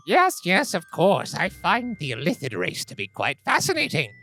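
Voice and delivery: put-on a polite old scholar musing over tea; elder gnome voice. GM voicing the elder gnome to the mind flayer, pleasant and chatty.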